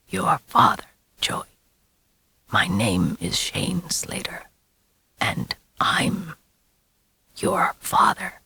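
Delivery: soft ghostly whisper in memory; echoing whisper. A narrator's reading. The father's words echoing in Joey's mind, whispered. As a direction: let it hang like an echo. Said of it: "his words still hung in the air".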